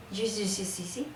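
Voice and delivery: mock Bogotá accent, tailing off softly; little rolo babble of ye and sí; rolo LL sounds. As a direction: imitate a tight-mouthed bogotano's LL. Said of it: "Y de la L".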